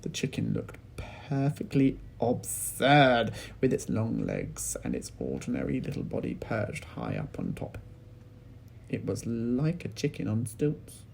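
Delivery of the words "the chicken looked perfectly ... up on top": ordinary delivery, nothing marked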